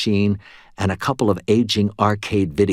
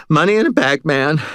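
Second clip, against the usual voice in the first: higher, louder frantic panting; 7 semitones higher, 4 dB louder than usual. A narrator's reading. Panicked cashier panting while stuffing money. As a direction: gasp it out. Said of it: "Stan panted".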